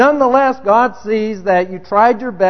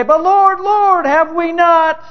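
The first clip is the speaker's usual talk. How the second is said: high, raised plea to the Lord, drawn out on the second Lord; pleading cry; 7 semitones higher, slower than usual. Retold or imitated. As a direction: beg the Lord in protest. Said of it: "those people say".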